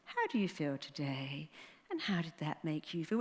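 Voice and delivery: lightly mock a dull shrink; imitating a psychiatrist. Mocking psychiatrists to the audience, soft droning therapist voice. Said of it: "So when you do that psychiatrist".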